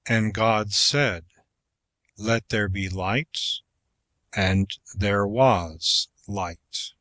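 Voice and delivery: slow, grave scripture reading; slow solemn reading. Shown as a demonstration. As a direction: read it slowly and solemnly. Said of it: "Render the following passages".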